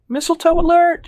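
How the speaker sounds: very high, loud squeal; excited shriek. A narrator's reading. Evelyn rushing up with mistletoe, shrieking. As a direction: shriek it like a party alarm. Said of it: "she shrieks".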